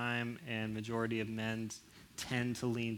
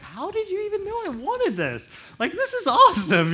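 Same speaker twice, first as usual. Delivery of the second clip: high, loud, thrilled gasp of delight; delighted gift reaction; 12 dB louder than usual. Retold or imitated. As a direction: squeal with joy over the gift. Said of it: "you're like".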